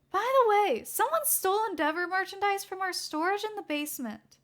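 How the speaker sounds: high, loud call after the boss; a high-voiced assistant calling out; high young woman calling to her boss.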